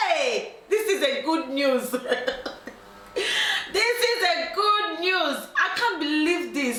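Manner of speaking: high, slow gleeful exclamation with laughter; hammed-up laughing celebration; overjoyed. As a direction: act out delighted relief, drawing words out. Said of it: "the woman was so happy".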